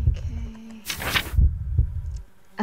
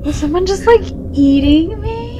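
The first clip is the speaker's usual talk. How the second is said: confused; 9 semitones higher, 4 dB louder than usual. Real, not acted. Player wondering what was just eating her, confused. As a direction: puzzle aloud in a raised pitch. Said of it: "I'm confused".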